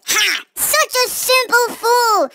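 a high, hammed-up villain gloat. The dummies gloating over the player, hammed-up and high. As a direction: cackle it like a cartoon baddie. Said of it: "They demanded".

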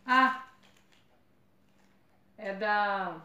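usual speaking voice

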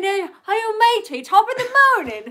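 high, hammed-up Irish greeting with matey; playful fake Irish accent; mock Irish accent. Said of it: "I was thinking".

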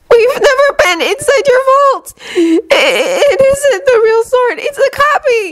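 very high, tearful and stammering protest; sobbing plea. A narrator's reading. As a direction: plead desperately through tears. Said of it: "Hermione sobbed".

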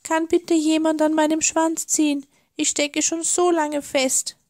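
high, thin, pleading; tiny stuck creature begging for help; frightened little voice. A narrator's reading. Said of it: "schluchzte ein leises, ängstliches Stimmchen".

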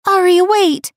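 high, loud call, punch wait. A narrator's reading. Friend chasing the Luna, loud call. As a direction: shout after her to stop. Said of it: "she called out again".

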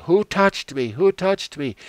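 Jesus turns around and calls out over the crowd, loud and high; insistent demand. Acted. Jesus insists on finding who touched him.

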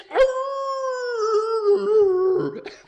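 long high, loud howl; cartoon howl. Dirty Birdie as his jaw drops, cartoon howl. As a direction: hold one high howling note, then let it slide.

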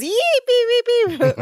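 high squealed beeping noise; goofy high-pitched beeps; imitated silly noise.

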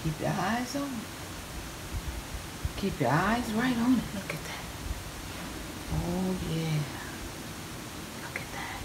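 very soft, sinking to a whisper; hushed. Real, not acted. Cook telling viewers to watch the dish, hushed. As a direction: draw them in with a hush.

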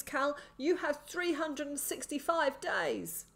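high, desperate madman's voice; gasping urgent warning. A narrator's reading. Pinned man begs boy to flee, gasping and urgent. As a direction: plead with him, forcing out each phrase. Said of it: "he said between gasps of breath".

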